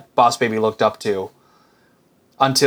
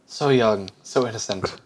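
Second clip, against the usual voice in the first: ordinary talk, nothing marked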